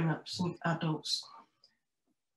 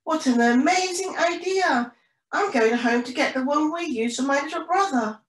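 high, louder storybook character; excited penguin friend; 8 semitones higher, 4 dB louder than usual. Acted. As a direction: gush about the idea, pitched up. Pilar praises idea, joins in.

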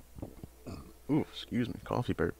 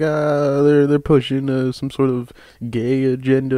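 mocking complainer; 4 semitones higher, 4 dB louder than usual. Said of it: "Some people are like".

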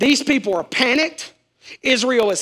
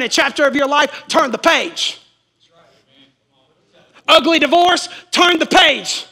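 high, loud, driving preacher cry; preacher shouting that God has this; full-force preaching; 6 semitones higher, 6 dB louder than usual. Acted. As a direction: lift the church with a shout about Moses.

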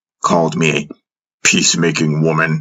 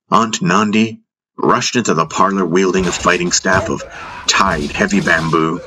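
plain reading voice

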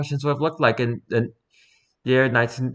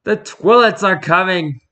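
excited announcement; 7 semitones higher, 5 dB louder than usual. Pretend. Announcing toilet ad punchline.

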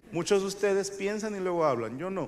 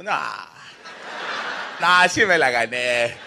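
slow, drawn-out, lower ex-con voice, loud; Grillo; 6 semitones lower, 5 dB louder, slower than usual. Retold or imitated. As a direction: play an unbothered convict owning up.

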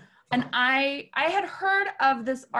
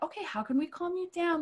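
much higher, coaxing sing-song; mock soothing; 8 semitones higher than usual. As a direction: coo at a tantrum. Producer soothing the star.

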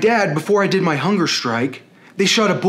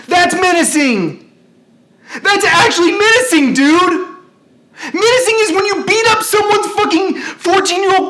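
high, strained, loud yelling; real outraged tirade; furious rant; 10 semitones higher, 4 dB louder than usual. Real, not acted. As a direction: hurl each accusation at a high pitch. Angry rant listing real menacing.

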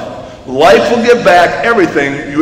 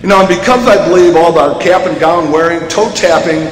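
ordinary talk, nothing marked

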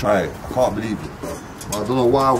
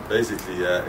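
usual speaking voice

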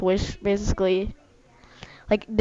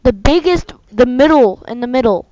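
loud, high directions; calling out; 3 semitones higher, 7 dB louder than usual. Real, not acted. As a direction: call the place out clearly.

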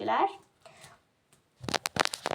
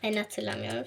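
usual speaking voice

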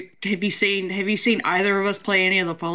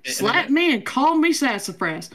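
slow, higher Southern drawl; Southern accent; 5 semitones higher, slower than usual. Acted. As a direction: play a folksy Southern yokel. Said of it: "We're all Southern".

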